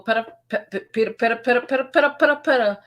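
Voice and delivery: rising pitter-patter chant, climbing high; mock pitter-patter. Mimics what sounded wrong on video.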